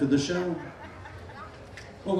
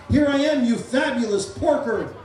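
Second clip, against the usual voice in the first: yelled greeting; 8 semitones higher than usual. Shown as a demonstration. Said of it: "stand up and yell".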